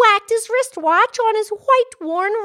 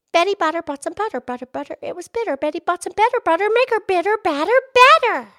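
rushed, fast tongue twister; fast tongue twister try; much faster than usual. Shown as a demonstration. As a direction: race through the Betty Botter twister. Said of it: "you gonna try it".

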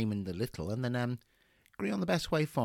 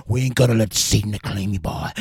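loud, ecstatic revival preacher; fervent exorcist preacher; 4 semitones lower, 10 dB louder than usual. Acted. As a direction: roar at the devil, full fervour. Exorcist ritual continues.

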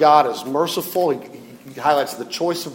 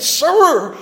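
a high, pleading cry of "Sir"; 11 semitones higher, 3 dB louder than usual. Retold or imitated. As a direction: beg him, voice lifted high. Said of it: "the woman cried".

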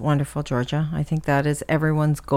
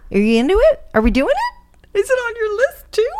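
rising eager pleas to win her over; excited pleading; 4 dB louder than usual. Real, not acted. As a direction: pitch climbing higher with each eager question. Eagerly pushing daughter into musical.

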